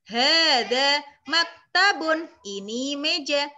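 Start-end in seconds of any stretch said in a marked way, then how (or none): 0.1-2.3 hadza maktabun, each vowel drawn out; slow, loud teacher's model of hadza maktabun; slow vowelled reading. Shown as a demonstration. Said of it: "sambil diharokati". (hadza maktabun dibaca pelan dengan harakat)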